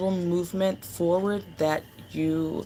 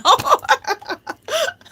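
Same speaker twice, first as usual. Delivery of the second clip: a high, loud burst of laughter; squealing giggle; delighted laugh; 9 dB louder than usual. Real, not acted. Delighted by her own joke.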